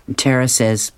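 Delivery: ordinary delivery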